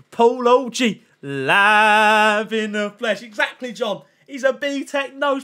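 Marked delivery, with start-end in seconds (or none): 0.1-3.2 loud, hugely stretched hype call; drawn-out announcement. Real, not acted. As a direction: stretch it out like a big introduction. Announcing someone in the chat.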